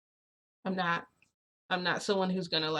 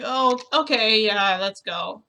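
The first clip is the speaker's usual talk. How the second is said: loud, raised burst of agreement; flustered agreement; 3 semitones higher, 8 dB louder, slower than usual. Retold or imitated. Agreeing to go finish the talk, flustered. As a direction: agree suddenly and a bit too loudly.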